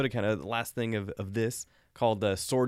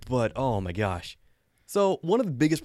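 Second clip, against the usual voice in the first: ordinary delivery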